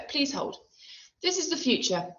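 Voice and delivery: the wife's voice, barely put on; lightly voiced wife. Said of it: "my wife says".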